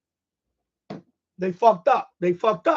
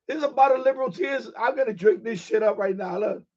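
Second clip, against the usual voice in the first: normal talking voice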